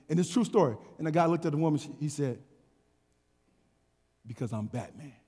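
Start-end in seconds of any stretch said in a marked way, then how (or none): 4.3-5.1 very low, hushed superhero voice; Batman voice. Retold or imitated. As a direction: drop deep and quiet, deadpan. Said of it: "he said it in the voice".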